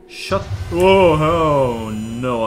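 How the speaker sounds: sudden loud "oh no"; jolted by a scare; startled yelp. Real, not acted. Jump scare in horror game.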